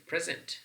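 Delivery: "present" stressed on PRES; first syllable of "present" carries the stress. Shown as a demonstration. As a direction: say "present" as the noun, PRES-ent. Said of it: "stress pattern is on the first syllable".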